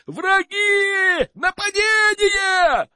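high, drawn-out alarm yell; wild yelling. Acted. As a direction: scream the alarm slowly. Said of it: "оглашая окрестности дикими воплями".

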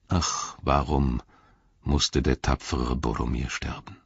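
hushed, almost whispered grief; sorrowful lament. Acted. Old king mourning Boromir, a hushed grieving whisper. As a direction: let the words sink into a breathy whisper of mourning.

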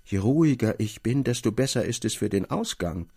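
plain reading voice

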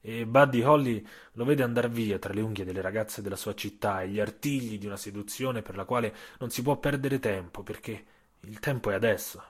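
usual speaking voice

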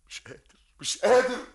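high, loud outburst of despair; anguished cry. Acted. [name] on tape crying out he cannot kill her. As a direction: let the helplessness explode. Recorded man cries out.

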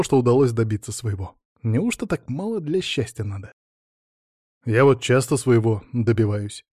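ordinary delivery, nothing marked

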